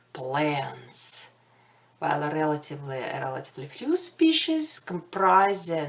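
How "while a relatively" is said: hurried false start; rushed. Real, not acted. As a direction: blurt "while a relatively" out fast. Rushed false start, then restart.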